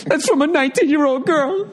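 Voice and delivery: high tearful mock sob; imitating crying. Owner showing his daughter's text, high mock crying. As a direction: choke the words out weeping, hammed-up. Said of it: "He just starts crying".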